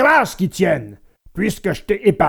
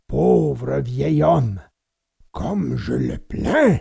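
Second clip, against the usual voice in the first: slow, low, tearful sympathy; heavy-hearted, slow low voice; pitying lament; 4 semitones lower, slower than usual. A narrator's reading. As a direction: draw it out, overcome with pity. Puppet master moved to pity.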